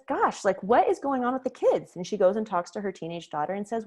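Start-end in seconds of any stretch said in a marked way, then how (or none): 0.1-2.0 higher, puzzled mom voice; a mom wondering aloud what happened; baffled mom. Retold or imitated. Mother puzzled after her kids stomp out, higher and bewildered.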